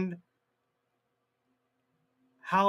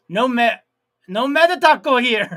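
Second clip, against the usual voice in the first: high, loud mock Spanish accent; 5 semitones higher, 8 dB louder than usual. Streamer joking about meta tacos in a mock Spanish accent. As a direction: toss it off in a hammy Spanish accent. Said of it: "with a Spanish accent".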